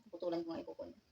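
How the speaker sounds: normal talking voice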